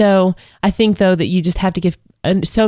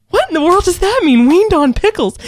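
much higher and louder, mock bewildered; baffled question; 12 semitones higher, 4 dB louder than usual. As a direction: replay your own disbelief at the odd saying. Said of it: "And I said".

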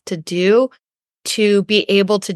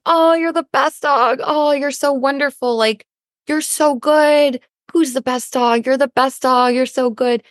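high-pitched adoring dog-praise voice; gushing pet talk; 5 semitones higher than usual. Retold or imitated. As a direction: coo praise at a beloved dog. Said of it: "as kindly as we talk to our pets".

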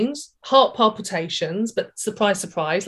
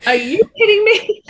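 1 mock outraged disbelief; 4 semitones higher, 5 dB louder than usual. Retold shocked outburst.

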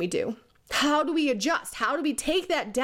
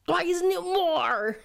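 higher, slow silly voice mocking clueless bosses; ridiculous mocking voice; 6 semitones higher, slower than usual. Mocking leaders who just demand more, higher and slow. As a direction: drag it out high like a baffled manager. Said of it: "because of my ridiculous voice".

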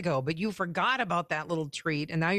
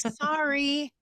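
mock sheepish son; 8 semitones higher than usual. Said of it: "And he's like".

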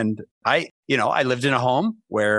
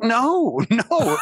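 mock wife insisting, half laughing; 10 semitones higher than usual. Wife correcting her husband about dropped food, high laughing insistence.

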